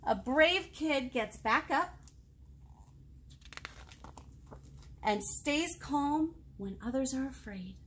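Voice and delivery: slow, warm read-aloud of a picture book; storybook reading voice, slow and deliberate; reading to children. A narrator's reading. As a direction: savour each line for the little ones.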